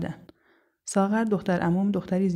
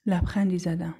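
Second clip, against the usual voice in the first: plain reading voice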